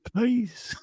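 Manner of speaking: whispered plea, rising into a high "please"; pleading aside. Retold or imitated. Promoter takes his friend aside to go on first, whispered begging. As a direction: beg under your breath, then let it squeak up. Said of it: "takes me aside and goes".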